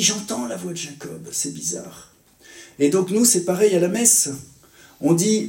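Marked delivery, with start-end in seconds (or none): 0.0-2.1 soft, trailing off to a breathy puzzlement; a hint of an old blind father, fading away; puzzled old father. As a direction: voice the blind old man, mildly baffled. Blind Isaac voiced, puzzled.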